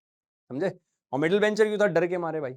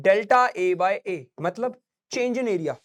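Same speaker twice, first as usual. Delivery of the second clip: slow dictation; much slower than usual. Shown as a demonstration. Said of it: "एक stress हम पढ़ लेते हैं".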